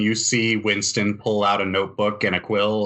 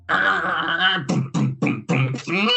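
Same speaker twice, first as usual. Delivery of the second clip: high mock cry, then spoken booms; imitated workshop racket; 7 semitones higher, slower than usual. Game master voices noises behind a closed door, imitated racket. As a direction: voice the bangs as sound effects. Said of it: "you hear".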